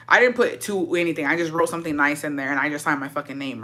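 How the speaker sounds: normal talking voice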